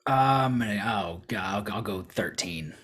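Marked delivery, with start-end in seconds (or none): none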